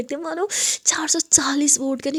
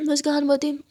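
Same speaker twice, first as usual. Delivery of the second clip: ordinary delivery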